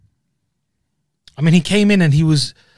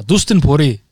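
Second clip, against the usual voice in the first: badly attempted Scottish accent; 5 dB louder than usual. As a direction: have a go at the name in shaky Scottish. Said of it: "That's Scottish".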